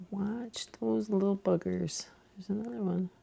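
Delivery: soft near-whisper; hushed aside. Real, not acted. Knitter watching for naughty latches closing, in a hushed near-whisper.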